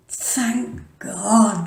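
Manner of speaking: relieved. Real, not acted. Relief at remembering.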